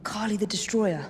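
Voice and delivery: ordinary talk, nothing marked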